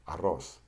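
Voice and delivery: arroz with a short trilled RR; rolled double R. Shown as a demonstration. Teacher demonstrating double R, rolled R in arroz. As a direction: flap the tongue a few times in arroz. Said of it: "flap your tongue two to three times".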